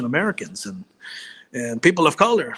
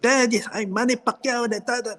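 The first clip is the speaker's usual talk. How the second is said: bad Manny Pacquiao; 8 semitones higher than usual. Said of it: "everyone's trying to do Manny Pacquiao and it's horrible".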